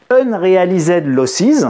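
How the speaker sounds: slow, careful English accent on "unrealized losses". Shown as a demonstration. Teacher giving the English finance term, English accent. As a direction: put on your best English accent for the term. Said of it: "Vous apprécierez mon accent anglais".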